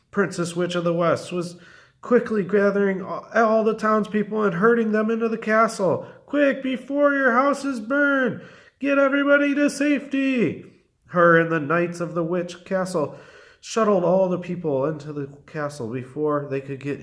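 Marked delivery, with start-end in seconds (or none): 6.3-10.7 urgent calling. A narrator's reading. Witch herding townspeople into the castle, urgent calling. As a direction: call out to hurry everyone to safety.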